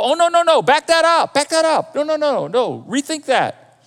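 high, mock-panicked objection; urgent interruption. Pastor stopping someone's prayer, mock panic. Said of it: "I wanna stop the prayer".

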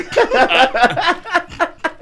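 very high squealing laugh; can't stop laughing; shrieking laugh. Real, not acted. Host laughing at joke.

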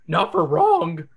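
high, slow yelping dog voice; Scooby-Doo yelp. Acted. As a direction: yelp it like a scared cartoon dog. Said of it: "Scooby yelps".

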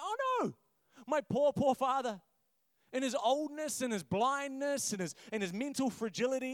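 mock panic at dad's mistake; dismayed son. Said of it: "Joseph's like".

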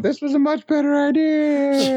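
mock falling kid. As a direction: yell it mid-fall like a reckless kid. Mock kid falling, cry heard.

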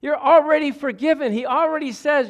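fervent Sunday preaching. Real, not acted. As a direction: lift the room with good news. Preaching full force.

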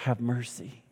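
humble beggar asking softly for mercy; soft plea. Acted. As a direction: drop to a soft, humble ask. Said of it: "But he said".

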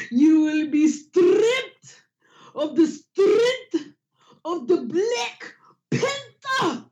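slow, high, hammed-up African accent; mock heavy African accent. Mocking Forrest Whitaker's heavy African accent, slow and high. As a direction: ham up a heavy African accent, dragging each word. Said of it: "why he talking like that".

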